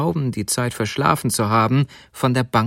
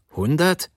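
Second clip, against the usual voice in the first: HUNDERT echoed as a higher, rising question; 5 semitones higher than usual. Acted. Stoker questioning the thief's price for the boots, questioning. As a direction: query Hundert with a lift at the end. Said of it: "fragte der Heizer".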